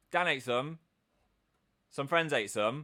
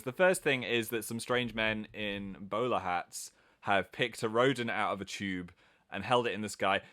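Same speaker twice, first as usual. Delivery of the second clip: usual speaking voice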